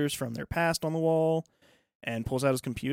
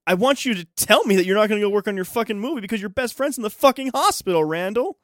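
higher, louder, fast furious rant; retold angry shout; 8 semitones higher, 7 dB louder than usual. Friend yelling at Randall about the movie, higher, louder furious rant. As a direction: yell it at him, fed up and fast.

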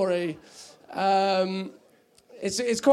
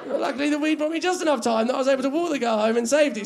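ordinary delivery

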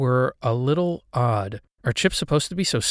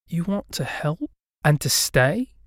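put-on higher child's voice, questioning; hesitant ghost girl; 5 semitones higher than usual. A narrator's reading. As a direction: ask in wonder as a little girl. Ghost girl disbelieves tenant's offer.

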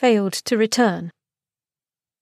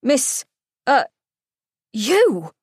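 spluttering; 4 semitones higher, slower than usual. A narrator's reading. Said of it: "he spluttered".

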